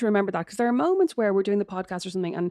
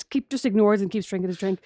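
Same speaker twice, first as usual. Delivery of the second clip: ordinary talk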